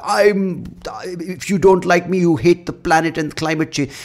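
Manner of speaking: high-pitched mocking activist voice; mock zealot. Mimicking people who say you hate the planet, high mocking voice. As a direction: whine it up high like a self-righteous zealot.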